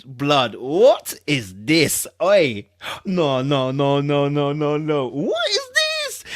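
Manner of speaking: can't believe what he's reading; incredulous disbelief. Real, not acted. Incredulous reaction to statement.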